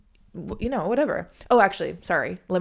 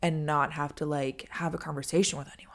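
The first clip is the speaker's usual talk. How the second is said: ordinary talk, nothing marked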